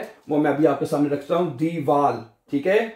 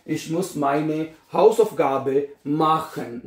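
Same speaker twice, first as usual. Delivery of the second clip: slow model sentence; much slower than usual. Shown as a demonstration. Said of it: "मुझे अपना homework करना है".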